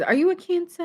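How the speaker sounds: high, playful sing-song question; silly sing-song voice. Pretend. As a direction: tease it out like a little tune. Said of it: "I don't know why I'm talking like that".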